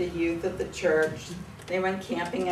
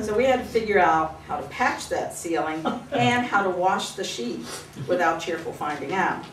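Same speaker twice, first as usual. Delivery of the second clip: usual speaking voice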